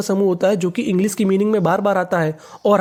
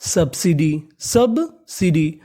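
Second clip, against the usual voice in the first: Subsidy very slow, split after the first syllable; slow, stretched teaching pronunciation of subsidy; broken into parts; 2 semitones lower, much slower than usual. Shown as a demonstration. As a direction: sound out subsidy in pieces. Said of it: "तो meaning बनी".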